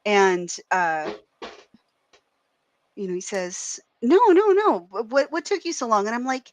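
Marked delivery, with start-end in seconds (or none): 4.0-6.1 high, loud protest opening, then easing down; excited insisting. Retold or imitated. Man at the bar insists she was expected, excited. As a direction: imitate an eager stranger who won't be put off. Stranger insists in acted pickup.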